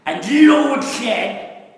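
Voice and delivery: loud, high, heavily slurred words; slurred speech. Retold or imitated. Old preacher with MS retelling the story, slurring loudly. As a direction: imitate a preacher with severe MS. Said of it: "That's how he talked".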